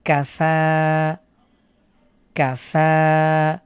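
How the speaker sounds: "কাফা" with কা short and ফা drawn out slowly; long final vowel. Shown as a demonstration. Teacher shows where the stretch falls in "কাফা". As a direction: hold only the ফা for one alif. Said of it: "কাফে টানা যাবে না".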